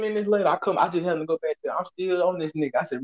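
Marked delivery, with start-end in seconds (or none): none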